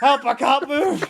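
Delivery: a slow, comic cry for help from the ball; mock stuck woman. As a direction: overplay being stuck and helpless.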